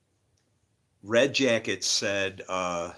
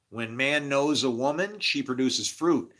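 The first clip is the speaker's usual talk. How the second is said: slow, louder preaching declaration; 4 dB louder, slower than usual. Acted. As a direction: proclaim it like a preacher from the pulpit.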